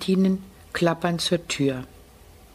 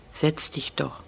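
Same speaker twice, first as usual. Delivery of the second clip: softer, calm and low invitation; deep and calm; 3 semitones lower, 6 dB quieter, slower than usual. A narrator's reading. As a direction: gently coax the boy to sit. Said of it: "Die Stimme des Capos klingt tief und ruhig".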